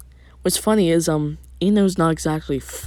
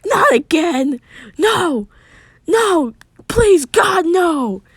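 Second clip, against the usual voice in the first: high, loud cries of despair; screaming; 12 semitones higher, 7 dB louder, slower than usual. A narrator's reading. Narrator voices a character begging for it to stop, screaming. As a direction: scream it out, pleading desperately. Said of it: "closes his eyes and screams".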